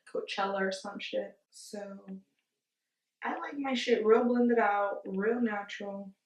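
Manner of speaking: usual speaking voice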